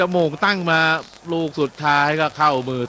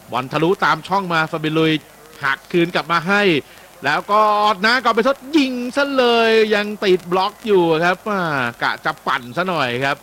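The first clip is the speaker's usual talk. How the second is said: quick, raised live match call, pitch up; excited play-by-play; 5 semitones higher, 4 dB louder than usual. Real, not acted. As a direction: call the attack as it happens. (พากย์บอลสดอย่างตื่นเต้น เสียงสูงขึ้น)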